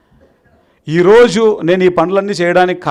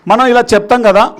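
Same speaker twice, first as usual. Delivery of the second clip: ordinary talk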